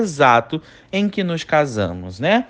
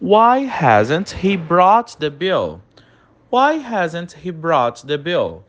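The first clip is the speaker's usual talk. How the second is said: slow, even English model sentence; slow dictation; much slower than usual. Shown as a demonstration. Teacher reading a sentence for students to repeat, slow and clear. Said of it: "repete comigo todas as frases em inglês".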